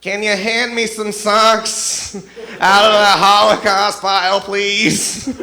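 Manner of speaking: very loud, high, drawn-out shout; exaggerated yelling. Bunkmate repeats offensive request even louder.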